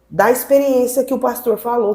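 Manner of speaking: ordinary talk, nothing marked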